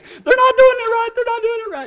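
high-pitched, loud whiny panic; mock freak-out. People freaking out at others, mock high screech. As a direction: screech it like a hammed-up complainer.

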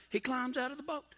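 ordinary talk

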